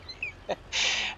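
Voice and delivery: short breathy cackle of amusement; real laugh. Real, not acted. Storyteller laughing at the trap door blunder, wheezy laugh. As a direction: let a wheezy laugh escape. Laughs at the spilled seed mishap.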